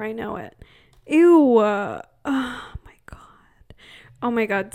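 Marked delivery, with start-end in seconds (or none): 1.1-1.6 grossed out. Real, not acted. Disgust at turning forty. 1.6-3.7 slow, breathless whisper of dread; horrified whisper. Real, not acted. Horrified at getting older.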